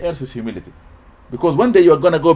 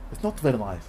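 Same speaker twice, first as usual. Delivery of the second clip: usual speaking voice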